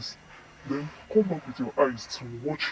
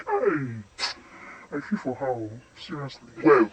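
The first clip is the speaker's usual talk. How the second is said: ordinary talk, nothing marked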